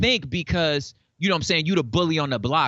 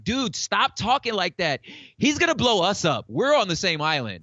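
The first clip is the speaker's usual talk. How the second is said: panicked plea; 8 semitones higher than usual. Retold or imitated. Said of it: "South Korea telling America, like".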